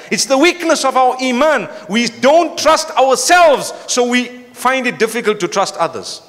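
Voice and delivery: high, emphatic rebuke to a congregation; rousing sermon lament; impassioned preaching. Real, not acted. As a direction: preach it hard, pitched well up. Preaches with force.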